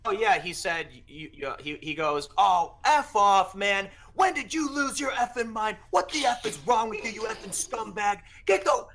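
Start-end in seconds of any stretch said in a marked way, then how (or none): 2.4-8.9 retold yelling. Cenk snapping at the speaker in the hallway, mock furious yelling. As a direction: imitate the angry outburst, pitched up. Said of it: "he just goes off on me".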